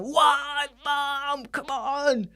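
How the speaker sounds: put-on high, pleading child's whine; whiny kid. Kid pleading with mum after being refused, whiny.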